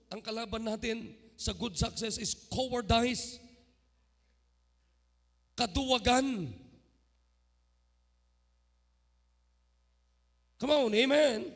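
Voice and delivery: rising to a loud preaching cry; loud, high altar call; preaching shout. Real, not acted. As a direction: hammer it home to the congregation. Preacher climaxes against cowardice.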